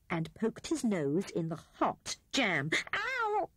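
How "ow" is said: high-pitched yelp of pain; squeaked "ow". A narrator's reading. Rat pokes his nose in hot jam, squeaking. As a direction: yelp as if burnt. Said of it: "he squeaked in a muffled voice".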